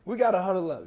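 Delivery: loud, high call to huddle; rally the team, half joking. Retold or imitated. Calling friends together.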